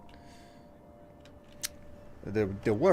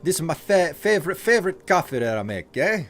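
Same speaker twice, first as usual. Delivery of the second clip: hammed-up Italian accent, higher and louder; Italian barista accent; 6 semitones higher, 3 dB louder than usual. Streamer putting on an Italian barista accent for chat. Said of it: "Oh, Italian barista".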